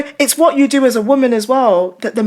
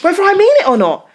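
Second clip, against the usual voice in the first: emphatic punchline; 11 semitones higher, 6 dB louder, faster than usual. Real, not acted.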